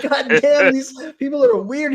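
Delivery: a hammed-up baffled father; imitating dad. Dad reacting to weird videos.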